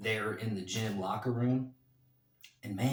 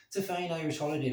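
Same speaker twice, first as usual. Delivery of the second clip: quick, higher put-on Irish accent; 4 semitones higher, faster than usual. Vlogger wishing viewers happy Saint Patrick's, in an Irish voice. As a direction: slip into a light Irish lilt. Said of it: "I love doing the Irish voice".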